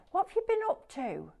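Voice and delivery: high-pitched teasing question; playful mock suspicion. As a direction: quiz the culprit in a high voice. Teasing viewer claiming innocence.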